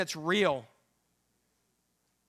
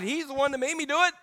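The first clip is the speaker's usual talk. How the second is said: ordinary talk, nothing marked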